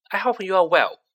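high, loud and self-important; pompous. A narrator's reading. Percy greeting Harry, pompous and formal. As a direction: greet him like a visiting official. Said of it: "said Percy pompously".

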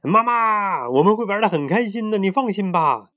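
high, loud, thrilled little brother; excited shout. A narrator's reading. Younger brother reassures mom on a call, excited shout. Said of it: "就激动地大叫道".

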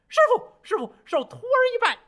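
high, loud monkey cry; monkey calling. A narrator's reading. Monkey calling his master from under the mountain, high and loud.

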